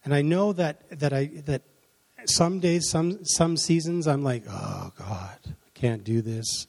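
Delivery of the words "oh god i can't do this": low, soft, slow groan of defeat; mock-defeated, sinking under the load; weary despair. Acts out feeling overwhelmed.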